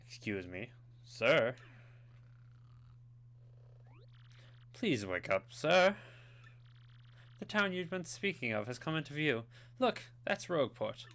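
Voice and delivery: lightly put-on posh accent; polite, posh ship's hand. Ship's hand waking a passenger, lightly posh accent. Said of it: "I gave him such a posh voice".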